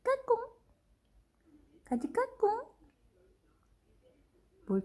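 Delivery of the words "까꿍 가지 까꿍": high peekaboo. Real, not acted. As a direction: coo it way up high.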